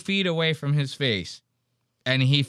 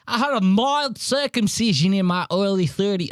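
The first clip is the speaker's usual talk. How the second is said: higher Australian accent, half-failed; attempted Australian accent; 7 semitones higher than usual. Shown as a demonstration. Streamer mocking the guest in a weak Australian accent. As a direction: try an Australian accent and let it slip. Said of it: "I can't do my Australian right now".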